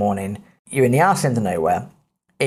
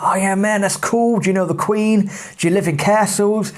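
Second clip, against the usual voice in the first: excited American tourist questions; 7 semitones higher than usual. Retold or imitated.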